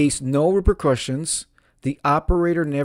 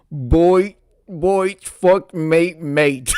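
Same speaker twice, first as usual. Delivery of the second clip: higher, louder hammed-up Australian; slow, drawn-out Australian imitation; mock Australian accent; 6 semitones higher, 6 dB louder, much slower than usual. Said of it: "but with an Australian accent".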